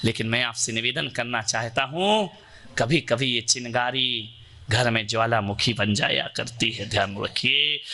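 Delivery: grave preacher's warning; solemn sermon. Acted. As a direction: lean in and warn the congregation.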